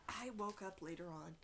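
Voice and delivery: ordinary delivery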